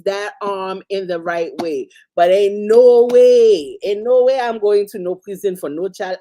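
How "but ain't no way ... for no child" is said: flatly refuse, punching every no; emphatic loud refusal. Real, not acted. Emphatic refusal, rising indignation.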